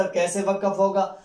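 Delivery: usual speaking voice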